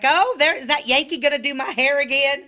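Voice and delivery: high, loud mock-teasing outburst; teasing salon client. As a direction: imitate a customer ribbing the Yankee, laughing as you go. Mimics Florida salon clients teasing her.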